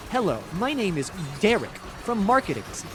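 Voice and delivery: hammed-up clueless PR person, halting and singsong; awkward marketing rep. Acting out a fake marketing email.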